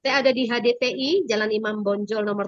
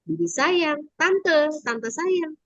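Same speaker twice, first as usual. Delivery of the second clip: higher, drawn-out endearment; affectionate calling; 5 semitones higher, much slower than usual. Shown as a demonstration. Aunt showing boy how to call her, drawn-out sweet call. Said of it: "Datang sini mendekat, lalu bilang".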